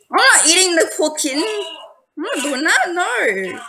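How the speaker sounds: high-pitched protest; appalled refusal. Real, not acted. Player refusing to eat the poor kitten, appalled refusal.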